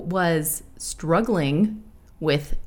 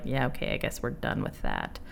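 fading into a defeated near-whisper; resigned shrug; 4 semitones lower, 8 dB quieter than usual. Retold or imitated. Fans shrugging off the show's end, fading resigned. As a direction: let it die away, giving up.

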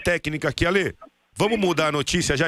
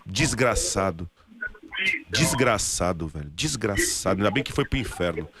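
muttered anger; 9 semitones lower than usual. Real, not acted. Reacts to shooting footage.

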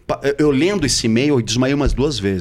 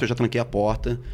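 usual speaking voice

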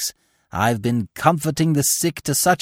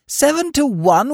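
high, drawn-out astonished question; stunned by the price; amazed; 14 semitones higher, 4 dB louder, slower than usual. A narrator's reading.

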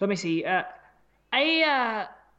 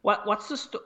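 ordinary talk

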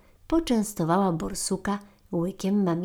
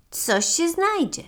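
carefree marmot; 9 semitones higher, 4 dB louder, slower than usual. Acted. Marmot breezily answers badger.

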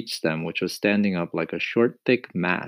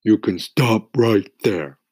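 sharp; 3 semitones higher, 7 dB louder, slower than usual. A narrator's reading. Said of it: "the earthworm said sharply".

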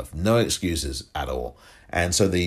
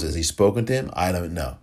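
usual speaking voice

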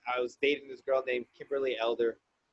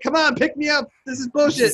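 much higher, louder mock plea to the sky; laughing yell; 12 semitones higher, 7 dB louder than usual. Begging the UFO to take him, laughing high yell. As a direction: shout up at the UFO, half laughing. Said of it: "I'm like".

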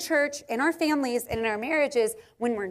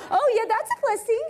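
put-on high, bright little-girl voice, much higher; daughter's voice; 11 semitones higher, 4 dB louder, faster than usual. Mother imitating her young daughter, high child voice. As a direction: play the four-year-old pleased with her answer. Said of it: "and she was like".

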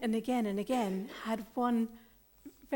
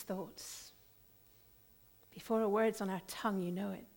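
prayer. Acted. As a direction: pray gently to the Father. Leads prayer.

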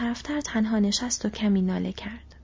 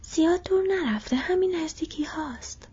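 high-pitched creature voice from overhead; Gollum's voice; 7 semitones higher than usual. A narrator's reading. Gollum answers Sam from above, high creature voice. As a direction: pitch it well up, a creature calling down. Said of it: "صدایی از بالای سر او".

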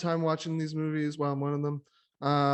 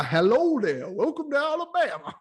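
high, put-on Southern accent; mock Southern accent; 6 semitones higher than usual. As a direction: play a cheesy Southern host greeting visitors. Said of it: "I'm not doing a Southern accent anymore".

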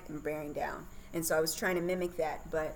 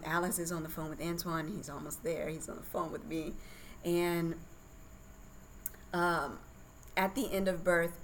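ordinary talk

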